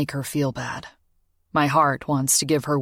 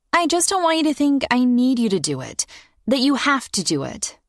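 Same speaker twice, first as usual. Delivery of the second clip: put-on young girl voice; 9 semitones higher than usual. Child voice in story.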